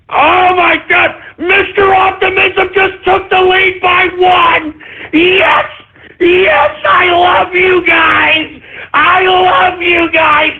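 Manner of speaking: ecstatic, high, shouted joy; excited. Real, not acted. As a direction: cheer it out, voice cracking. Celebrating taking the lead.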